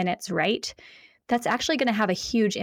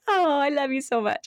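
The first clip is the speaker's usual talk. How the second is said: higher-pitched gushing reply; imitating a child won over by love; melting affection; 5 semitones higher than usual. Child responding to mom's sweet greeting, gushing. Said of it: "how do you respond to that".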